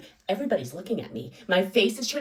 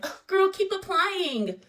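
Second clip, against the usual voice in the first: high, slow, sing-song mock pity; tactless girl; 10 semitones higher, much slower than usual. Coworker consoling her tactlessly, high slow mock pity. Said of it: "one girl who has no couth was like".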